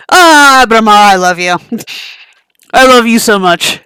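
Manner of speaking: loud, delighted declaration; shower the beast with love; loud gushing love. Acted. To Brahma the behemoth, loud adoring gush.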